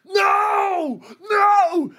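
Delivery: high shouted mock no; imitating the TikTok sound. [name] voicing the TikTok sound clip, high shouted no. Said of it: "The sound was like".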